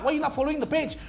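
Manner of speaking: usual speaking voice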